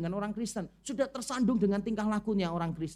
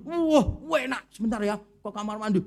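fake praise; 7 dB louder than usual. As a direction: overdo the compliment, then dash off. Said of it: "Terus dulu". (pura-pura memuji dengan keras lalu kabur)

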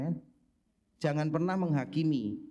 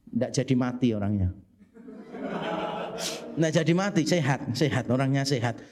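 fervent preaching; 2 semitones lower, 6 dB louder than usual. Acted. Sermon climax at full force.

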